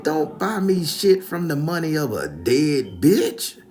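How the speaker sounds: high, loud, slow scolding; acted scandalized rebuke; outraged grandma. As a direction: draw out each word in loud indignation.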